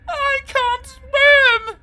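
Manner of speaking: very slow, high, loud sobbing; blubbed. A narrator's reading. Helen sobbing to the Bogman, high and loud. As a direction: blubber it through tears. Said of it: "she blubbed".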